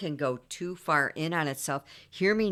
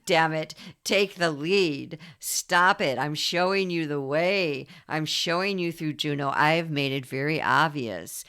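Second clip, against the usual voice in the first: louder, stern goddess voice laying down the law; commanding dark goddess; 5 dB louder than usual. Acted. Hecate ordering the listener to heal, loud and stern. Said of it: "Hecate would rise as the dark goddess and say".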